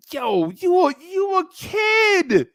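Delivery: high, mocking laugh of a teenage girl. Teenage Lisa laughing at the eleven-year-old who asks her out, high and mocking. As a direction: laugh off the little kid's crush in a squealing voice.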